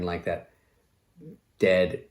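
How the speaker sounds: ordinary talk, nothing marked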